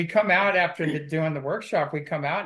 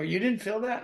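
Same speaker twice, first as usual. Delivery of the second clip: higher-pitched retold disbelief; mildly acted amazement; incredulous question; 8 semitones higher than usual. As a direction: quote their astonishment with a raised voice. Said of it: "They go".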